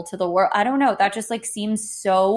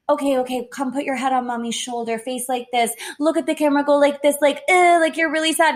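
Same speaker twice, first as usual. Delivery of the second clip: hammed-up stage-mom coaching the kid's pose; pushy vlogger mom; 5 semitones higher, faster than usual. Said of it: "she was like".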